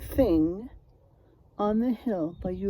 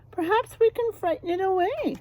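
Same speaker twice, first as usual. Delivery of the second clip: higher, sly suggestion; scheming wolf; 10 semitones higher, 3 dB louder than usual. Acted. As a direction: propose the plan eagerly. Wolves voiced in story.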